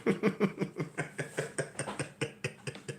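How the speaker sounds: soft wheezy laugh trailing on; helpless quiet laughing fit. Real, not acted. Laughing at his own story.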